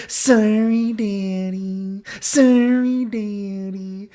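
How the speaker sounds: put-on high, long drawn-out baby-doll apology; imitated drunk girl. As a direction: stretch each word in a girly singsong.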